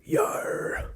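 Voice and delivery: very soft, unvoiced pirate rasp; pirate growl. Answering what accent pirate is, whispered pirate growl. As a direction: growl it under the breath like a pirate. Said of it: "what accent is pirate".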